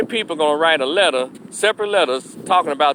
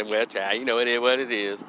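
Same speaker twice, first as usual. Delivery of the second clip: soft, lower, resigned sigh of a voice; resigned shrug; 5 semitones lower, 6 dB quieter than usual. Retold or imitated. A hint of a resigned worker telling customers it is what it is. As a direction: let it go with a tired shrug. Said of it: "We just say".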